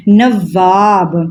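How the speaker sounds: نواب drawn out slowly, nə-ʋaab with the و as v; slow model word. Shown as a demonstration. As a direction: sound out نواب very slowly for children. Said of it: "یہ کیا پڑھا جائے گا".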